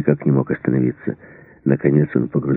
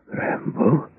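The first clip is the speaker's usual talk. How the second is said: breathy, high rising question, softer; stunned disbelief; 10 semitones higher, 7 dB quieter than usual. A narrator's reading. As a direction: barely get it out, reeling from shock. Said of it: "От этих слов Мердок даже пошатнулся". (потрясённо, не веря)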